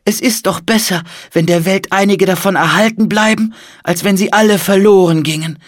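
put-on high-pitched old man's voice; pleading old monk. A narrator's reading. Old monk wants his life's work saved, pleading. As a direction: reason with them, high and insistent.

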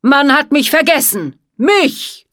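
loud, high furious villain; angry shout. Acted. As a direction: rage at being forgotten. Villain rages.

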